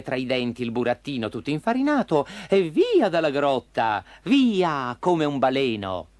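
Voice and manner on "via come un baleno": usual speaking voice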